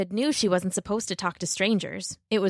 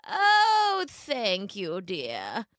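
wolf as grandmother; much slower than usual. A narrator's reading. Wolf thanking Little Red, falling fake granny voice.

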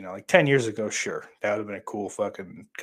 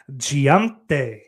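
put-on GIANTE drawn out with a Spanish accent; 3 semitones higher, 7 dB louder than usual. Streamer acting out a chat message, mock Spanish accent. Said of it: "Giante with a Spanish accent".

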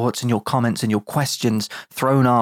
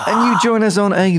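announcer voice, louder and higher; radio DJ voice; 8 semitones higher, 5 dB louder than usual. Pretend. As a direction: keep up the cheesy presenter act. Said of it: "I was trying to do my Pryor D J voice".

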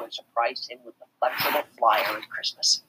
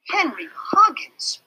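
slow, high whispered exclamation; exclaimed; 4 dB louder, slower than usual. A narrator's reading. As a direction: gasp out his name. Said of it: "she exclaimed".

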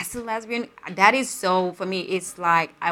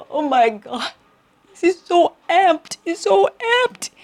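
much higher, slow, rapturous gush; gushing over a poem; 10 semitones higher, 4 dB louder, slower than usual. Retold or imitated. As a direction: swoon over the poem, rising higher each time. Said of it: "I'm like".

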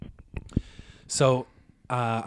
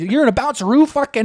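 put-on loud, high super Canadian accent; hammed-up Canadian scolding; Ace Burpee; 10 semitones higher, 7 dB louder than usual. Radio host scolding boy, exaggerated Canadian accent. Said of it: "He's super Canadian accent".

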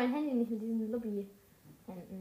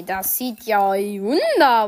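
mock admiration; 3 semitones lower, 11 dB louder, slower than usual. Exaggerated praise of the craft.

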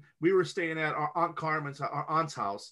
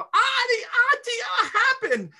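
put-on high, louder Puerto Rican accented alarm; 5 dB louder than usual. Grandmother upset, calling in Puerto Rican accent. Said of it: "she kinda would get to that accent".

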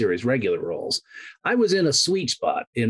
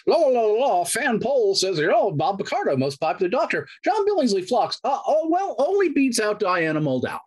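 gloating sing-song; 12 semitones higher than usual. Retold or imitated. Mocking rival's gloating fan poll.

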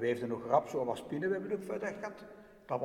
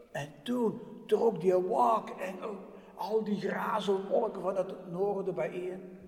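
ordinary delivery, nothing marked

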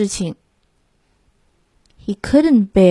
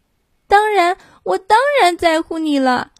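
lamb voice; 8 semitones higher than usual. Acted. Lamb's voice in story.

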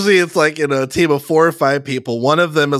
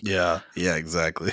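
quiet laughing; 7 semitones lower, 10 dB quieter than usual. Real, not acted.

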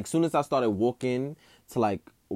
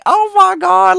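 a sudden very high, very loud squeal of disbelief; stunned squeal; 18 dB louder, slower than usual. Retold or imitated. Hearing the judges hype him up, an ecstatic high shriek. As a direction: shriek it like you can't believe your ears.

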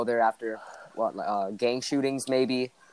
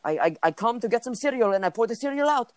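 higher, broken-English imitation of a foreign friend; hammed-up immigrant-kid voice telling his story; friend's foreign accent; 6 semitones higher than usual. Guy imitating his friend from a foreign land telling about cereal, mock accent.

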